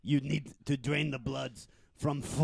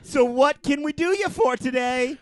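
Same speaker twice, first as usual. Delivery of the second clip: high chipper salesman; 10 semitones higher, 6 dB louder than usual. Acted. Assassin character on phone.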